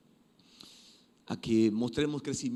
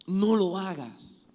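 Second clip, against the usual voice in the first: slow, higher and louder repeat; drawn-out insistent warning; emphatic warning; 6 semitones higher, 6 dB louder, much slower than usual. Acted. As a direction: plead with them firmly.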